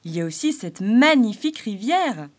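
exclaiming. Acted. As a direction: proclaim the river's beauty.

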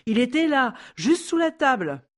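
telling it with rising alarm; agitated. Acted. Sandra's anxious retelling.

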